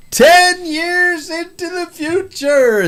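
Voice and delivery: slow, high announcer voice; game show host voice. Pretend. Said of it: "I'll stop doing that".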